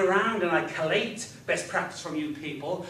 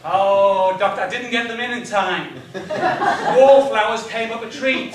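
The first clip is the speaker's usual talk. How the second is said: hammed-up confused patient; 10 dB louder than usual. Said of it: "if I go along to see my doctor and he asks".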